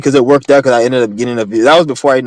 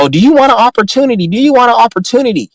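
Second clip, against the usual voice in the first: much higher, sing-song mock salesman pitch; 10 semitones higher than usual. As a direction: ham up a fake-friendly stranger making a pitch. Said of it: "Some stupid ass cracker coming up to me talking about".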